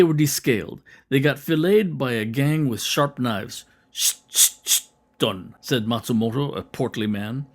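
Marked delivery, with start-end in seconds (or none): 3.9-5.5 knife-swish shh sounds. Retold or imitated. Imitating swift filleting knife strokes.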